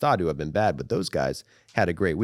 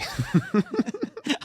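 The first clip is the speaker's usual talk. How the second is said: high laugh; 13 semitones higher, 3 dB quieter than usual. Real, not acted. Laughs at the accent bit.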